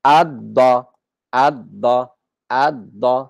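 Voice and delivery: ad-da with a doubled d, repeated; ad-da, no l heard; assimilated ad-da. Shown as a demonstration. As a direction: drill ad-da, lam merged. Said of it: "We don't say al-da".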